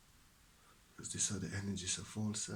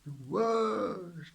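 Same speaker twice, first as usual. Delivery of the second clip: much higher and louder, ringing out; joyful exclamation; exclaimed; 9 semitones higher, 8 dB louder than usual. Real, not acted. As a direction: call out the word with delight. Echo of the phrase.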